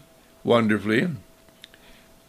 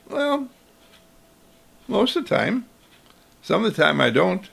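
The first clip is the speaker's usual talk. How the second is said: put-on sheepish child; 5 semitones higher than usual. Child confessing he doesn't always share, sheepish and high. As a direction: admit it a bit sheepishly.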